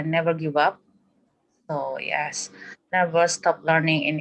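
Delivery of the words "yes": ordinary talk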